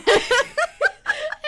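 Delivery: very high crying laugh; shrieking laugh. Real, not acted. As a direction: laugh until you're near tears. Laughing with friend.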